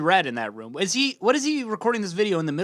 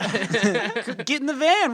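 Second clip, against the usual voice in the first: mock auntie voice; 3 semitones higher, much slower than usual. Auntie character leading a protest.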